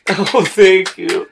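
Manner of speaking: high, loud, drawn-out thanks; pompous actor. Acted. Actor bowing to an adoring audience, grandly. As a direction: bask slowly in the applause. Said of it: "he's so pompous looking".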